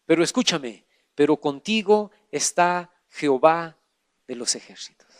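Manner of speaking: drops low and soft to reassure; quiet, tender preacher's promise; hushed comfort. Real, not acted. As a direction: lean in and bring the voice right down. Pastor turns to comfort the sick.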